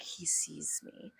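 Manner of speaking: slow, hushed acted whisper. Dancer noticing her stalker watching, whispered. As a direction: whisper it slowly, like a secret. Retold secret realisation while being watched.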